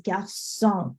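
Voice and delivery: garçon with an s sound in French; soft C. Shown as a demonstration. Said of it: "soften that C".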